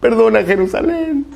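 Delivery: slow, high, drawn-out wail; hammed-up tearful plea to the Lord; mock weeping lament. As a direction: imitate the crying prophet Jeremías. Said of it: "Jeremías se pasaba llorando".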